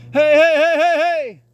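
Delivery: high, loud rapid hey calls; driving calls. Real, not acted. Trainer pushes the pup forward with loud rapid calls. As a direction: drive the pup forward with quick shouts. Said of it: "My cadence is gonna pick up".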